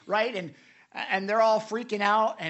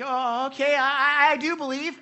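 higher, louder, stammering confession; honest dad; 8 semitones higher, 8 dB louder than usual. Retold or imitated. Honest dad confesses his faith to Jesus, stammering and high. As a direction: stumble over the I's, earnest. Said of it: "He's like".

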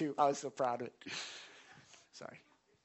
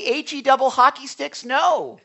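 higher, louder playful hard refusal; emphatic no; 6 semitones higher, 8 dB louder than usual. Real, not acted. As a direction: slam the door on it with a grin. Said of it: "that's an emphatic no".

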